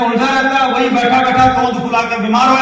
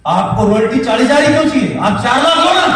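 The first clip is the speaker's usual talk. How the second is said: high, loud motivational push; rousing challenge; 6 semitones higher, 4 dB louder than usual. Real, not acted. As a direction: challenge the crowd at full voice. Rousing crowd to aim higher. (जोश से ललकारते हुए)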